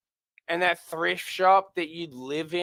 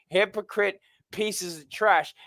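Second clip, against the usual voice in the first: ordinary talk, nothing marked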